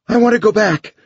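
high, loud panicked plea. A narrator's reading. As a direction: beg to turn back in panic. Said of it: "There was panic in her voice".

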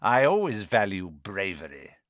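low, menacing villain; sinister dark lord. A narrator's reading. Evil face praising Harry's parents, low and sinister.

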